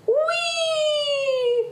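long, very high squeal; gleeful cry. Acted. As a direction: whoop like a kid on a galloping horse. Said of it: "cried Bob".

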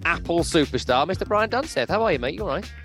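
usual speaking voice

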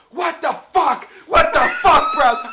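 very loud, high-pitched imitation of an irate fan; outraged fan yelling. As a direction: shout it like a dad losing it at a game. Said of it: "stands up and goes".

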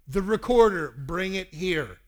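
retold angry dad, loud; stern command. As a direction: bark the order at your son. Angry dad demands the recorder.

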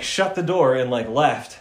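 usual speaking voice

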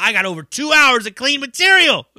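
loud, high-pitched imitated grievance; complaint tone. Comic griping to a friend about clean cruise-ship gigs, mock complaining. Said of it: "in a complaint tone".

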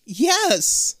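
high retold cry of agreement; exasperated mock pleading. Beth answers Amanda from inside the mirror, mock pleading. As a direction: agree desperately, as if trapped. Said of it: "hearing Beth scream from the other side".

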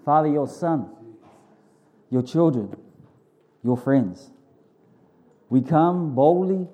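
fervent, earnest pastor's prayer. Acted. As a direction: pray it out boldly to God. Leads the congregation in prayer.

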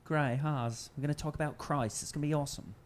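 lightly voiced peppy youth pastor; a hint of an upbeat pastor. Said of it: "I play an Australian youth pastor".

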